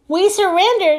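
high frightened cry of surrender; panicked yell. Acted. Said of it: "yelled Mayor Turkey Lurkey".

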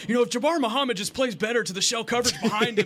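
high, loud mock sports know-it-all; imitating armchair commentator. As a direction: impersonate a friend loudly lecturing at the game. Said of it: "some family in section one oh six ... just hearing you".

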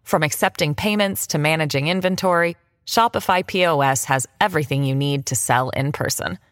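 ad read. Acted. Read ad spot.

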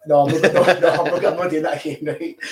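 real amused protest breaking into laughs; laughing refusal. Real, not acted. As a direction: refuse through laughter, loudly. Jokingly refuses to attempt Japanese names.

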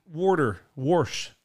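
"Water, wash" as "worder, worsh" in Pennsylvania accent. Shown as a demonstration. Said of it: "what's the Pennsylvania accent?".

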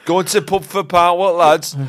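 rally the lads in broad Yorkshire; Huddersfield accent. Acted. Huddersfield local inviting the lads to pub, loud Yorkshire accent.

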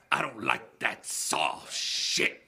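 very slow, drawn-out tough-guy line; movie villain imitation. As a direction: drag out each word like a menacing captain. Quoting film villain's line.

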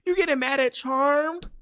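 high, mocking disbelief; incredulous. Real, not acted. Mocking the man who hates Charmed, incredulous. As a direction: ask it like it's absurd.

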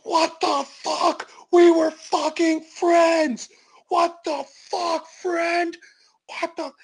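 high, slow, anguished disbelief; betrayed outcry. Retold or imitated. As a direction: wail it like a hurt friend. Homeless guy betrayed while choking Bobby.

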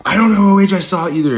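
high, loud, fast frightened voice; high hurried freaked-out brother; brother's panicky squeal. Retold or imitated. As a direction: rush it out, rattled. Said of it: "we're, like, yelling".

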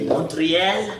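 French accent. Shown as a demonstration. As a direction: say the city as a Frenchman would. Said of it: "little bit of a French accent".

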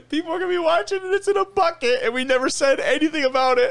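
high, laughing through the words; laughing hard. Real, not acted. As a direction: crack up while talking. Laughing hard at forgotten foot.

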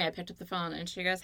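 usual speaking voice

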